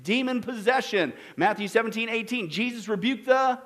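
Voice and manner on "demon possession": raised, higher plea for mercy; a woman's urgent cry for help. Acted. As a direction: cry out to be heard, pleading. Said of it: "crying out".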